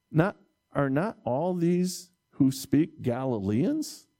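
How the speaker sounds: normal talking voice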